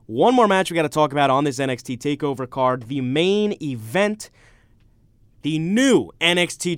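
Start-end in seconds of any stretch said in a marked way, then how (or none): 0.0-1.4 radio host intro. Acted. Radio host welcomes listeners back.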